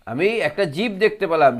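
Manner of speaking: normal talking voice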